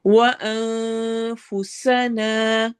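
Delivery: slow chanted tajweed with a long drawn ha; Quranic recitation. Shown as a demonstration. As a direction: recite it, stretching the vowel. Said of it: "غنہ ... لمبا کرنا".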